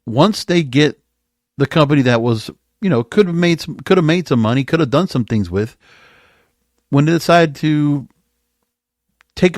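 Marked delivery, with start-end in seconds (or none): none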